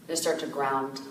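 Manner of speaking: calm, softened voice with long pauses; guided meditation. Real, not acted. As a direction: gently guide listeners inward, leaving space to breathe.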